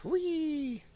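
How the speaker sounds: high, soft "wee"; tiny drawn-out squeal of play; playful squeal. Pretend. Playing while resizing the screen.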